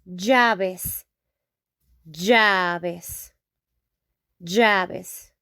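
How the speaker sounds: llaves with ll said like a y or zh sound; teacher modelling llaves; Spanish double L. Shown as a demonstration. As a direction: start llaves with a buzzing y. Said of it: "Here we say".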